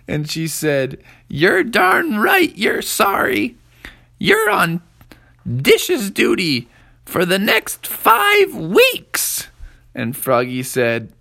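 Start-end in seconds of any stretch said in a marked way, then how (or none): 1.3-9.5 very high, loud, slow mother laying down punishment; a mom handing out dish duty; high, loud, drawn-out scolding mother; stern mom. A narrator's reading.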